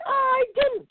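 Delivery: high, squealing denial; high bird voice denying; very high squawk. Acted. Hawk and heron quarrel.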